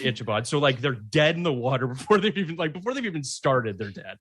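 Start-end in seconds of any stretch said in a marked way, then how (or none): 0.0-0.5 "Ichabod" said Itch-a-bod with a ch; "Ichabod" with a ch sound; mispronounced name. Retold or imitated. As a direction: start "Ichabod" with itch, not ick. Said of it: "they just straight out said".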